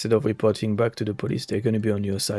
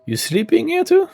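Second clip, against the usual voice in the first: surprised; 6 dB louder than usual. Real, not acted. Streamer finds someone sleeping there.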